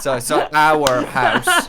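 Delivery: loud joking correction with a laugh. Acted. Actor joking about the house line, laughing apology. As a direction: play the slip for laughs. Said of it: "Wrong house".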